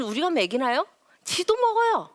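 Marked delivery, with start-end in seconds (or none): 1.3-2.1 put-on higher, louder child voice; child's voice. Child answering the parent, lightly voiced kid. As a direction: say it like a sulky kid.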